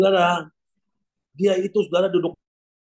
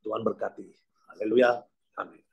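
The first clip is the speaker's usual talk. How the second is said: ordinary talk, nothing marked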